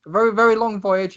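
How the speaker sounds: high, brisk captain urging everyone aboard; bustling Tesla. Acted. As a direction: hurry them up the gangplank. Tesla hurrying crew aboard.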